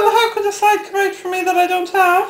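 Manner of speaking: high, quick playful protest; mock indignation. Arguing with Titch over North Dublin side, mock indignant. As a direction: argue back fast and high, mock offended.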